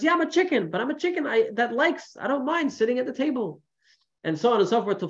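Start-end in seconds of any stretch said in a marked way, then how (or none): 0.0-3.6 high, easygoing mock reply; farmer chicken. Farmer answering the prince, high and easygoing. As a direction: brush it off cheerfully. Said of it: "He says".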